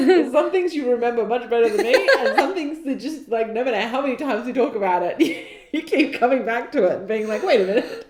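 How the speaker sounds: laughing while teasing. Real, not acted.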